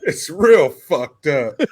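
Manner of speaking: slow, deliberate delivery; repeating the funny line slowly for effect; slow drawn quote. Retold or imitated. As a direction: drag each word out.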